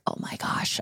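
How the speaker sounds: whispered, breathless awe; awed whisper. Retold or imitated. Hearing her sing a cappella up close, a hushed awed whisper. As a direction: whisper it like you can't believe your ears.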